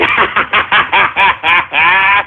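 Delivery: long deep villain cackle; evil cackle. Acted. Streamer mocking trolls with an evil cackle. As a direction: cackle like a mad overlord.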